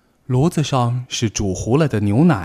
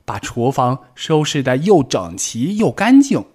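higher, louder eager rabbit; happy; 5 semitones higher, 5 dB louder than usual. Acted. Tiaotiao to Bengbeng, happily planning the cleanup. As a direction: announce the plan gladly. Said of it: "跳跳高兴地说".

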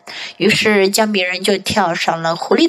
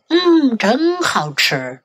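sly fox voice; 2 semitones higher, slower than usual. Acted. Fox savouring meal.

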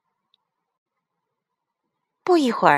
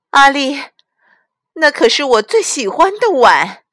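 high, loud motherly dismay; dismayed exclamation; 9 semitones higher, 8 dB louder than usual. A narrator's reading. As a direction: cry out in upset protest to the child. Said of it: "妈妈惊呼起来". (妈妈又高又响地惊呼责怪)

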